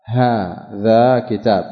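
drawn out, each syllable of هذا كتاب stretched; slow demonstration. Shown as a demonstration. Teacher showing students the form, slow and drawn out. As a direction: spell out هذا كتاب slowly for the class. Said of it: "فإذا أتيت بحرف التنبيه تقول".

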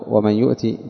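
يؤتي said "yu'tii", ending in i; Yaqub's reading. Shown as a demonstration. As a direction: end يؤتي on an i sound. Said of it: "أما على قراءة يعقوب". (يؤتي بكسر التاء)

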